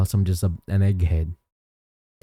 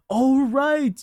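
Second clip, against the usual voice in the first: surprised realization; 13 semitones higher than usual. Real, not acted. Suddenly remembers a request.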